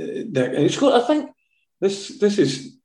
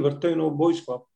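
ordinary delivery

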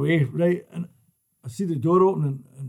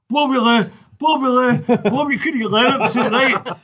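louder, higher call, stumbling on the name; calling across the bar; 8 semitones higher, 7 dB louder than usual. Retold or imitated. Big guy calling down the bar to Bobby, loud and stumbling. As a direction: shout over the pub, tripping on the name.

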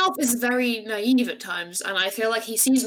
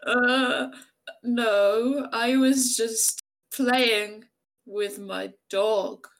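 very slow, halting excuse with long gaps; bad liar; much slower than usual. Arnulf lies to a suspicious orc, halting and unconvincing. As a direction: stall while inventing a poor cover story. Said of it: "she's not a very good actor".

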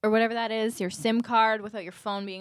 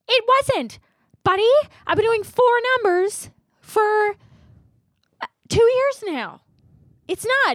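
high-pitched exasperated whine, louder; high indignant whine; 13 semitones higher, 5 dB louder than usual. Retold or imitated. Retelling to her friend, mock whining at the Bell guy. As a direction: whine it like a wronged customer.

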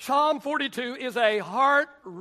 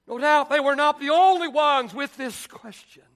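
higher, rising sermon cadence; preaching at full force; 3 semitones higher, 3 dB louder than usual. Acted. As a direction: press the point home to the church.

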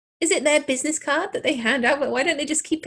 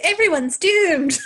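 higher, louder, drawn-out cry of doom; mock doomsayer; 7 semitones higher, 5 dB louder, slower than usual. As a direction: echo the crazy old prophet, hammed up. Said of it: "why are you always doing an accent".

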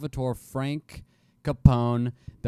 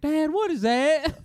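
put-on child to dad; 4 dB louder, slower than usual. Child in passing limo.

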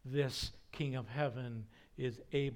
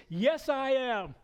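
loud, high, drawn-out irritated reply; 9 semitones higher, 7 dB louder than usual. Retold or imitated. Moses finally answers Bush tugging his robe, loudly irritated. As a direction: answer with slow, emphatic exasperation. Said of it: "in an irritated voice".

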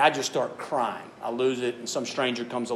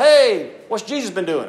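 parent calling out; 6 semitones higher, 8 dB louder than usual. Retold or imitated. Father calling out at camp.

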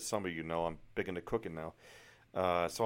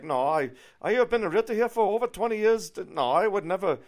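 high, loud German-accented protest, imitated; indignant German realtor; 11 semitones higher, 9 dB louder than usual. German realtor defending twenty years of practice, indignant mock accent. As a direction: protest like an affronted German woman. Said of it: "tell by the accent she's obviously from Germany".

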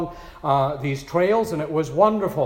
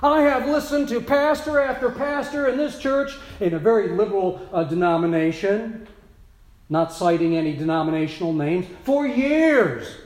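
higher, louder earnest parishioner; grateful parishioner; 5 semitones higher, 4 dB louder than usual. Retold or imitated. Parishioner thanking her pastor after thirty years, earnest and raised. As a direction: voice an older woman's heartfelt thanks. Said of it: "she said".

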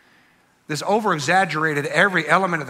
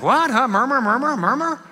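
high mock-bewildered muttering crowd; confused murmuring sailors; 9 semitones higher than usual. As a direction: mumble like baffled sailors, much higher. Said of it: "So be confused".

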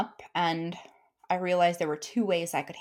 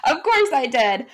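laughing; 6 semitones higher, 8 dB louder than usual. Real, not acted. Laughing about crying in meeting.

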